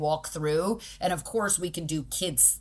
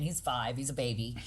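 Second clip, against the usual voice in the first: ordinary delivery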